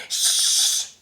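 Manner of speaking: loud unvoiced shh; loud shush. A narrator's reading. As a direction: hush the bear. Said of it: "said the rabbits".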